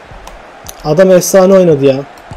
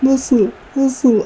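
stunned high-pitched cry of despair; anguished disbelief; 9 semitones higher, much slower than usual. Real, not acted. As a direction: wail in shocked disbelief. Disbelief after conceding late.

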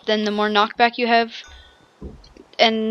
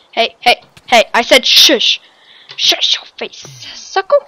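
louder playful "hey, I said shush"; mock scolding; 9 dB louder than usual. Player mock-scolding an opponent, louder playful telling off. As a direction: tell off a rival teasingly. Snapping at opponent mid-fight.

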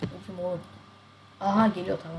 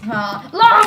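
much higher, loud outburst; startled shout; 9 semitones higher, 10 dB louder than usual. Real, not acted. Streamer hit by surprise, sharp startled yell. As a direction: yell it out in shock.

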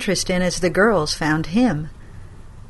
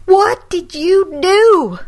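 slow, much higher, louder demand; appalled grandma; horrified demand; 12 semitones higher, 7 dB louder, much slower than usual. Acted.

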